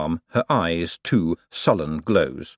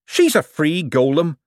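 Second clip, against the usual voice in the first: sharp; 7 semitones higher, 3 dB louder than usual. A narrator's reading. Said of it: "said Adora Bell sharply".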